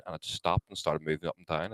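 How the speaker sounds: ordinary delivery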